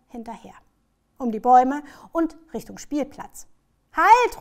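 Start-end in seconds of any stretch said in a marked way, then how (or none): 3.9-4.4 high, loud warning call. A narrator's reading. Grandma calls after child and dog, loud shout. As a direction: call urgently across the park. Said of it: "ruft Oma".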